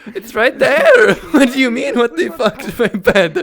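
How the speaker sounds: can barely speak for laughing; helpless laughing outburst; real laughter. Real, not acted. Cracking up at the scene.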